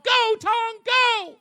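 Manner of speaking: high, loud shouted cheer from supporters; mock cheering. Host imitating supporters cheering Tong on, high mock shout. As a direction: whoop like a fanboy crowd. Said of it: "they're all cheering him on".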